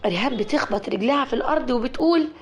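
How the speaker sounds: plain reading voice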